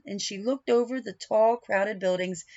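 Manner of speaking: ordinary delivery, nothing marked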